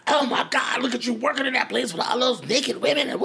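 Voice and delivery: high, whooping imitation of a delighted dad; thrilled father. Imagined thrilled father, son being straight.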